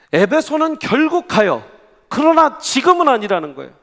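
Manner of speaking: loud, high emphatic declaration; emphatic preaching. Real, not acted. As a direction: drive the point home loudly. Preacher stresses key point. (목소리를 높여 힘주어 강조)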